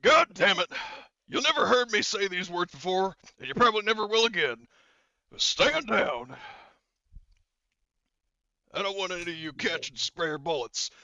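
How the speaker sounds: loud, high-pitched exasperated order; curse, then order the squad to stand down; Horgus. Acted. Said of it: "Horgus is thinking about it".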